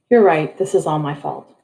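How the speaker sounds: ordinary delivery, nothing marked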